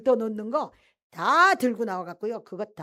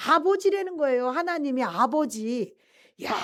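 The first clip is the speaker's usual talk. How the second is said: ordinary talk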